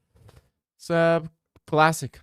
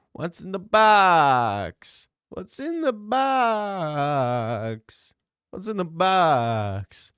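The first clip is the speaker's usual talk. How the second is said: high, slow anguished mock scream; Se7en box scream; 7 semitones higher, 5 dB louder, much slower than usual. Imitating the famous movie scene, slow strained yell. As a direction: howl it like the detective in Se7en.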